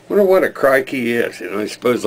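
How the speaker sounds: normal talking voice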